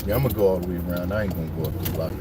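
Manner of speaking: muttering to himself. Real, not acted. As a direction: talk low under the breath. Talking to himself while riding.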